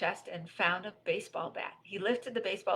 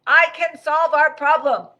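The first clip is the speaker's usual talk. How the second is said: loud, high, slow shout; robot shouting; 9 semitones higher, 15 dB louder than usual. Acted. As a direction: project it loudly, word by word. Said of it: "he shouted".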